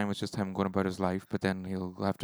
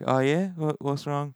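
lightly imitated Australian accent, pitched up; casual Australian questioning, higher voice; a hint of Aussie in a higher-pitched query; 6 semitones higher, slower than usual. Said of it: "the Australian accent always makes".

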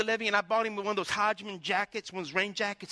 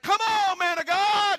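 high, loud, exuberant preacher's yell; imitated shouting; 8 semitones higher, 6 dB louder, slower than usual. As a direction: holler it out like the excited pastor. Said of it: "he's shouting".

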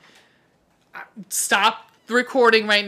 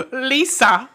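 "Lisa" said higher and louder in a mock nature-narrator voice; Richard Attenborough; 5 semitones higher, 6 dB louder than usual. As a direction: announce "Lisa" like a documentary narrator. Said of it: "This is my Richard Attenborough".

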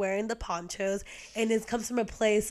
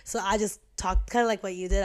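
ordinary delivery

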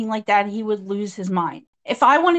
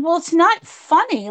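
higher-pitched, mildly indignant retort; retold indignant objection; 7 semitones higher, 3 dB louder than usual. Answering a partner's so-called joke, retold indignation. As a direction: re-enact pushing back, pitch raised.

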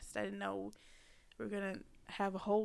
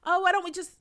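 mock eager start; 9 semitones higher, 9 dB louder, faster than usual. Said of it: "when you be like".

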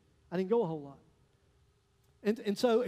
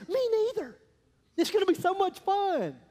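much higher, drawn-out gleeful reply; eager excitement; 11 semitones higher, 5 dB louder than usual. Retold or imitated. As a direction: sell it like a thrilled kid. Pastor's naive eager reply.

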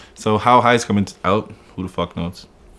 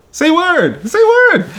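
high squeaky mock disbelief, much higher and louder; retelling his shocked reaction to the cashier, way up high; incredulous exclaim; 4 dB louder, slower than usual. Retold disbelief at ID check.

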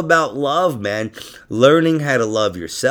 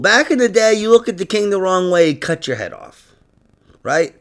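ordinary talk

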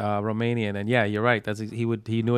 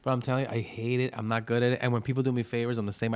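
ordinary talk

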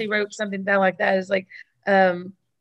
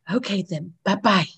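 secretary voice. Retold or imitated. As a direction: sign off in a hushed receptionist voice. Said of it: "She's doing the same voice".

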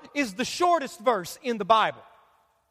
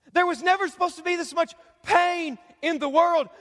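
forceful, sustained sermon delivery; impassioned preaching; 6 semitones higher than usual. Acted. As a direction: preach it with mounting insistence, driving each phrase home.

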